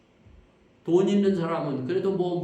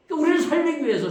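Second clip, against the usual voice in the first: a quick, loud, higher rush of preaching; sudden fervent burst; 9 semitones higher, 7 dB louder, much faster than usual. Real, not acted. Preacher bursting out fast to the congregation, loud and high. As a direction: surge up fast to stir the congregation.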